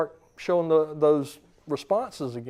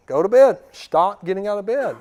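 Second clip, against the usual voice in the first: loud, raised-pitch scolding; firm parental order, punched at the start; mean, hard parent; 4 semitones higher, 6 dB louder than usual. Retold or imitated. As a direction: lay down the law at bedtime. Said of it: "mean to her".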